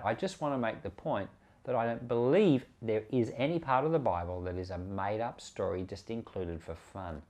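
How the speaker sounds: ordinary talk, nothing marked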